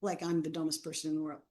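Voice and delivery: ordinary talk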